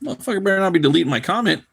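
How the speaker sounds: high, suspicious grumble to himself; annoyed inner voice. Retold or imitated. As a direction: wonder aloud, getting irritated. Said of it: "I was like".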